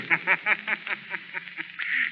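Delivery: high, echoing sinister laugh; ghostly triumphant cackle; eerie mocking laugh. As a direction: laugh like an unseen phantom mocking his prey. The Shadow mocks the villain.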